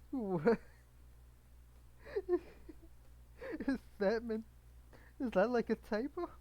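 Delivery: high, loud and laughing; really cracking up in disbelief; baffled laughter. Real, not acted. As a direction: ask it loudly through giggles. Cracking up at a garbled line.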